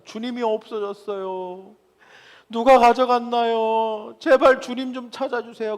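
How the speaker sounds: high, chanted lament with drawn-out endings; distraught self-talk. Acted. Preacher acts Mary searching for the Lord, distraught high wailing plea. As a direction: wail the plea like someone out of her mind. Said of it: "막 미친 사람처럼 막 혼잣말을".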